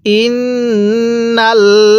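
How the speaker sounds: ইন্নাল্লাযীনা intoned very slowly, the nasal held with ghunnah; teacher modelling ইন্নাল্লাযীনা in tajweed; slow Quranic recitation. Shown as a demonstration. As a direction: chant ইন্নাল্লাযীনা slowly, holding the double n. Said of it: "কিভাবে শিখব?".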